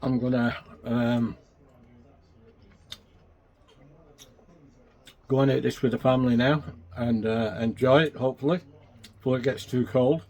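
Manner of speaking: talking through a mouthful; chewing while talking; mouth full. Real, not acted. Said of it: "Excuse me talking with my mouth full".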